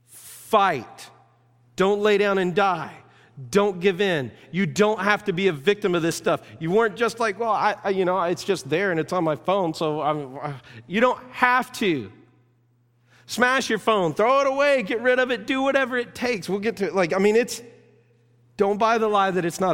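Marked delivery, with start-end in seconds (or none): 0.1-12.1 high, loud exhortation; impassioned apostle urging the church; Paul's rallying cry. Retold or imitated. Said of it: "Paul would jump in and go". 13.3-16.5 high and loud, pressing hard; preacher pleading at full force; urgent command. Acted.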